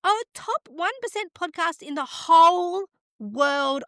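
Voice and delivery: gasp, then marvel at it, stretched out high; thrilled disbelief. Real, not acted. Astonished at the podcast ranking.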